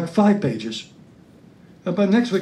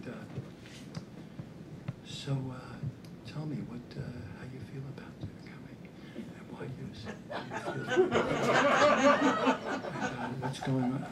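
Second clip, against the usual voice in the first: whispered, barely voiced therapist; breathy hush in a dark room; 8 semitones lower, 15 dB quieter than usual. Comedian imitates the dark-room therapist in a whisper. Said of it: "sat in a room really dark".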